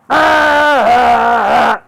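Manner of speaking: high, very loud sustained bellow; dragon roar. Acted. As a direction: bellow long as an angry dragon. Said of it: "then the dragon goes".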